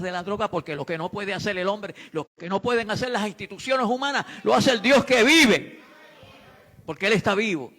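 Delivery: building from firm to shouted, high and loud; fiery preacher crescendo; preaching at full force. Real, not acted. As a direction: rise into a thundering sermon climax.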